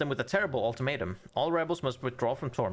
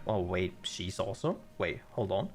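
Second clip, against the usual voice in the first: ordinary talk, nothing marked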